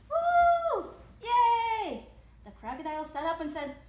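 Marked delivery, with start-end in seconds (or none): 0.1-2.1 very loud, high-pitched whoop; cheering. A narrator's reading. Astonished creatures celebrate the mouse, loud cheering. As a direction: cheer out like a delighted crowd. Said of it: "creatures began to cheer".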